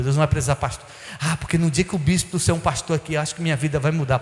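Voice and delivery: usual speaking voice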